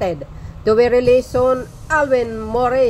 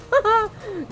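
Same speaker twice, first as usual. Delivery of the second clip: high mocking giggle; 12 semitones higher, 3 dB quieter than usual. Real, not acted. Mocking laugh.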